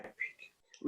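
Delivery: voiceless, very soft thanks; faint whispered thank you. Retold or imitated. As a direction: breathe the thanks. Teacher quietly thanks.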